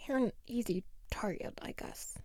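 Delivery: fast unvoiced whisper, fading out. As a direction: admit it under your breath. Bully's quiet confession.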